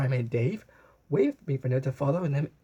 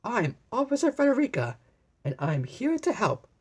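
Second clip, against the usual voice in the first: a bright, higher eager little voice; exclaiming Frederica; 9 semitones higher than usual. A narrator's reading. Child playing police officer, announcing herself, higher and eager. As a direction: proudly announce yourself as the helper. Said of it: "exclaimed Frederica".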